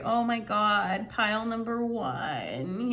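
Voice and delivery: lightly put-on East Coast accent, slow and sweet; drawn-out East Coast accent, doe-eyed. Tarot reader voicing the needy partner, mild East Coast accent. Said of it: "I'm talking in an East Coast accent".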